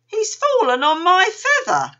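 very high, loud little-kangaroo voice; high, loud whine of complaint; complaining youngster. A narrator's reading. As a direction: protest like an indignant child.

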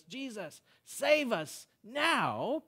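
high, drawn-out plea; crying out. Acted. Preacher showing how we cry out to Jesus, crying out. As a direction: cry it out long and slow. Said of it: "cry out to Jesus".

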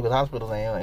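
usual speaking voice

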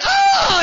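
sudden high freaked-out yelp; a high panicked cry; mock terrified shriek. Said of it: "like this, you know".

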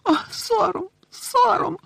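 distraught little elf squealing with grief; tearful wailing elf. A narrator's reading. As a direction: wail it in a piercing elf voice. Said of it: "знову заливаючись слізьми".

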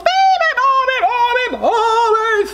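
high, loud, booming chant; thundering. A narrator's reading. Moose and buffalo wake the bird, thundering. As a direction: thunder the nonsense words out. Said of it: "thundered the moose and the water buffalo".

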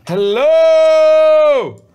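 high, very loud, long-held shout; yelled hello. Real, not acted. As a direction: call out across a great distance. Muted [name] calling out loudly.

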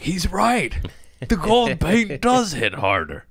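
high, wired junkie agreeing; squeaky excited addict voice; tweaker voice. Retold or imitated. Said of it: "All my tweakers like".

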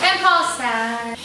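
slow, drooping mock sadness; play-acted sadness. Teacher ending the parachute game, slow mock sadness. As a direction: let it sag slowly to a stop. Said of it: "show how sad he was".

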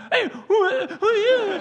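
high eager dog voice; mock excited dog. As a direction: play the dog thrilled by a stranger outside. Dogs voiced greeting coyotes.

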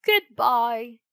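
"Goodbye," called out loud at normal pitch. Acted. Fox and cat calling after Pinocchio, loud call. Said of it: "called the fox and cat".

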